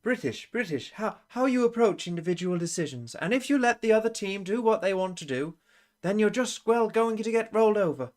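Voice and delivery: high-pitched, put-on British accent; Kiko, pansy British. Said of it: "It's pansy British".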